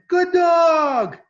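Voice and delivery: loud, very high praise for a dog; high, happy doggy praise; high-pitched voice. Shown as a demonstration. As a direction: squeal it to the dog, high and drawn out. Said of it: "in a high-pitched voice".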